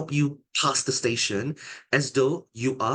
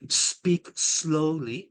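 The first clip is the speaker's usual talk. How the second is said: deliberately slowed, calm delivery; slow demo; 3 semitones higher, much slower than usual. Shown as a demonstration. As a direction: speak slowly, each word drawn out. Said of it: "like how I am now".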